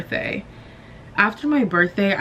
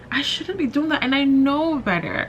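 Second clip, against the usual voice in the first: pitched up high, rising as she scolds herself; higher self-scolding; 7 semitones higher than usual. Real, not acted. Vlogger telling herself off for comparing careers, pitched high. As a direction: jump way up in pitch, chiding yourself.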